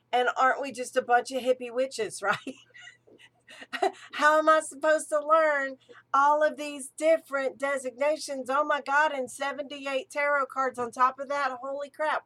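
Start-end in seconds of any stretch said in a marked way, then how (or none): 4.1-12.2 overwhelmed exclamation. Real, not acted. Overwhelmed by witchcraft's many details.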